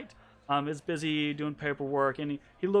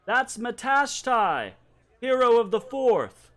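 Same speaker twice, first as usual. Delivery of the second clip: high, proud proclamation; calling out from behind; 8 semitones higher, 5 dB louder than usual. Acted. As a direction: announce a hero to the guards. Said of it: "you hear this voice call out from behind you".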